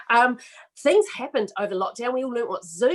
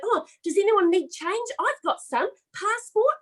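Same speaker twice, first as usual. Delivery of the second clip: helpful pouch offer; 10 semitones higher than usual. Pretend. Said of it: "I'm like".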